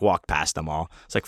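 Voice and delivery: usual speaking voice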